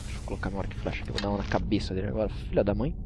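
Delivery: soft, quick hushed whisper; hushed sneaking. Real, not acted. Gamer sneaking up to snipe an enemy, hushed whisper. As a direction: keep it down while sneaking up.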